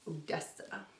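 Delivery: whispered name; soft unvoiced whisper. Real, not acted. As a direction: breathe the name to yourself. Checking the name softly.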